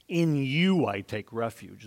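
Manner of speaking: slow, weight on "you"; stress on you. Shown as a demonstration. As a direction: lean on "you" and let it land. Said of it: "The emphasis in the sentence is".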